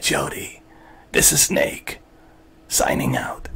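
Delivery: slow, hushed soldier voice fading to a whisper; Solid Snake voice. Pretend. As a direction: play a grim soldier reporting in, slowly. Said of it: "We'll do it in a Solid Snake voice".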